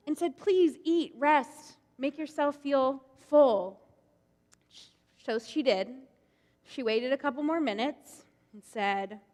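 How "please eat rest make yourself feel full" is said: put-on kindly old Abraham. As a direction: fuss over a weary guest like a doting old host. Abraham voiced in told story.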